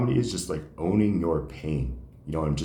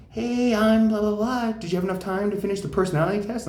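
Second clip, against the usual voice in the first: high, sing-song fake-friendly receptionist; mock receptionist voice; 13 semitones higher than usual. Retelling the therapy intake, mocking the receptionist in a high chirpy voice. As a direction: play the chirpy clinic receptionist.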